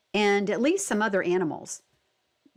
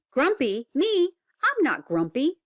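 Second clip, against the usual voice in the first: indignant grumpy monkey; 6 semitones higher, 3 dB louder than usual. Acted. Jim denies again, louder.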